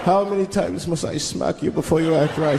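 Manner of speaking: the Count shaking down his worker; pimp Count. Pretend. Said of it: "You just see him pimping".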